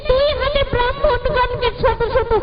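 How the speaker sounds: high, tearful plea to the Lord; weeping prayer. Acted. Mother weeping to the Lord over her son, pleading. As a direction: cry out to God in despair. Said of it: "মা আমার কাঁদতে কাঁদতে বললেন".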